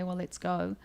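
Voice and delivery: ordinary delivery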